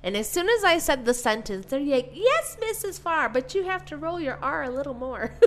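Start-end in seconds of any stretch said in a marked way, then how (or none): 2.1-5.3 put-on high, laughing kid voice; child voice. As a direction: correct the teacher like a cheeky pupil. Kids voiced in story.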